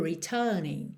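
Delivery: "returning" with a long middle vowel, no R; British "retuuning"; British accent. Shown as a demonstration. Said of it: "imitando o sotaque britânico".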